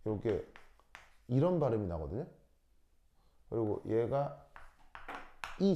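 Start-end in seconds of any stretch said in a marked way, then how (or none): none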